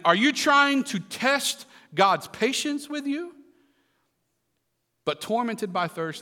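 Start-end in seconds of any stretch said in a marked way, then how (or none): 0.0-3.3 high, slow, drawn-out challenge; commanding rebuke. A narrator's reading. Moses rebuking the people who test God, commanding. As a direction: scold them, stretching the question out. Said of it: "Moses commanded".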